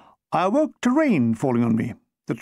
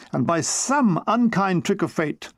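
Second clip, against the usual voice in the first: ordinary delivery, nothing marked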